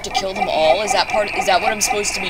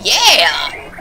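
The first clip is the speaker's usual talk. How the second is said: usual speaking voice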